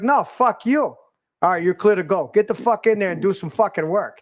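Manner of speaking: rough-house ribbing between work buddies; mock tough banter. Friendly swearing banter at gate.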